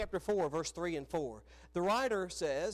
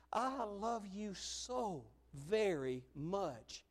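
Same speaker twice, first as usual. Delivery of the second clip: slow, tender and heartfelt; a father's unhurried declaration of love; tender affirmation; 4 dB quieter, much slower than usual. Acted. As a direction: let each word land slowly, lovingly. Models telling children they are loved.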